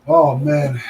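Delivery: usual speaking voice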